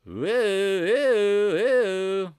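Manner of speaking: high, loud hooting woo; mock excited woo. Streamer mimicking the choppy market for viewers, hooting woo. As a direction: whoop along with the choppy chart.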